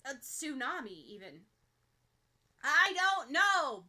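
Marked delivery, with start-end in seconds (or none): 2.6-3.8 loud, slow bellow. Acted. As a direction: roar it at her, drawn out. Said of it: "Bakugo bellows".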